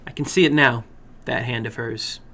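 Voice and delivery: usual speaking voice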